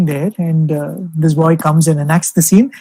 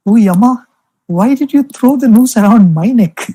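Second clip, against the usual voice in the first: gentle pleading Shiva; 5 semitones higher, 3 dB louder than usual. Retold or imitated. Boy playing Shiva gently asks Yama, mildly pleading. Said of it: "In the most gentle of voices".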